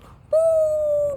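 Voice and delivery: high loud imitated blast-off woo; a shrieking sound-effect woo; mock explosion whoosh. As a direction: cry out like someone flung by a bomb. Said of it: "do that big ass effect thing".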